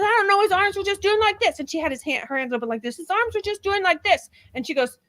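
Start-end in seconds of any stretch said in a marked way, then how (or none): 0.0-1.6 higher, put-on voice of the woman, imitating her; mocking the accused. Host imitating the accused answering the prosecutor, mock high voice. 3.0-4.3 higher, put-on voice of the woman, imitating her; mocking the accused. Host imitating the accused answering the prosecutor, mock high voice.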